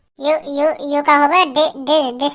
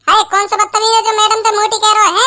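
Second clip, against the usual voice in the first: angry teacher; 5 semitones higher than usual. Acted. Teacher scolds rude pupil.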